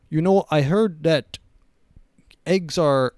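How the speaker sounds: a hammed-up Russian accent, dropped at once; Putin in Russian accent. Voicing the Russian leader on egg prices, abandoned mock Russian accent. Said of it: "I'm not gonna do a Russian accent".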